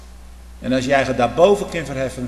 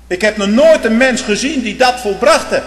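loud, higher pulpit declaration; fervent preaching; 9 semitones higher, 10 dB louder than usual. Real, not acted. Preacher to his congregation, fervent loud preaching. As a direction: declare it to the whole church.

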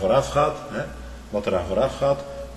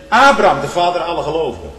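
proclaiming; 5 semitones higher, 7 dB louder than usual. Acted. Calling out Abraham.

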